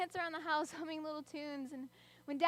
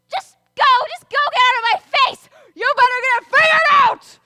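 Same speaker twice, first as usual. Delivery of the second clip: high, loud screaming fight; furious yelling; 8 semitones higher, 18 dB louder than usual. Acted. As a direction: scream to end the argument. Parents arguing.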